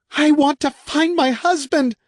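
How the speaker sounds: high, slow anguished cry; desperate woman. A narrator's reading. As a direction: wail for her husband. Kay desperate.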